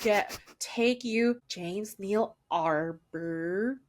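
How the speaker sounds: put-on very slow American accent with laboured R's; bad American accent. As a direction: fake American, dragging out each word. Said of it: "they don't say the hard R".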